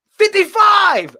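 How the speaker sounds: high, slow mock shout; mocking viewers. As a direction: yell the number like a pushy viewer. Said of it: "They be like".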